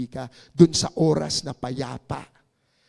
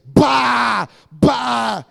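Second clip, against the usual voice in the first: loud mock shouting call; imitated shout; 7 semitones higher, 8 dB louder than usual. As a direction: call out like in a crowd. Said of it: "Some people were shouting". (ginagayang sigaw)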